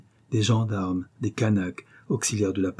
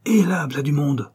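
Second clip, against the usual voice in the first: higher, louder warning; alarmed hiss of warning, raised; sudden alarm; 6 semitones higher, 4 dB louder than usual. A narrator's reading. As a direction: snap to alert, someone's coming. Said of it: "sa voix changea".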